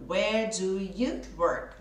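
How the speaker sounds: "Where do you work?" drawn out slowly, linking do and you; slow demo. Shown as a demonstration. Teacher slowing down to show how do joins you. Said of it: "Junto do com you".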